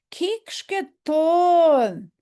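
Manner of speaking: "Kikshketon" drawn out very slowly, high and loud; careful pronunciation. Shown as a demonstration. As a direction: spell out each sound of "Kikshketon". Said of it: "put the G in front of the S H".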